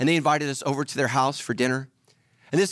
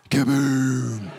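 loud kaboom; 5 dB louder than usual. Retold or imitated. Stunned realization punchline.

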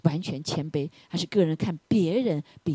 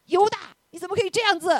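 high, loud mock outrage at Judas; imagined high-pitched reproach; mock scolding; 12 semitones higher, 5 dB louder than usual. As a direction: act out an indignant telling-off.

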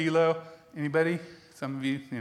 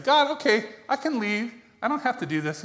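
raised-pitch mock plea to leave; pleading to God; 6 semitones higher than usual. Preacher retells begging God to let him leave. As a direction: beg God to let you quit. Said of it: "I said".

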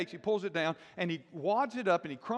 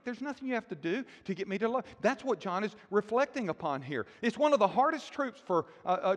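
ordinary delivery

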